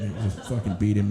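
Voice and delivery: usual speaking voice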